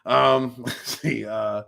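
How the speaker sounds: ordinary delivery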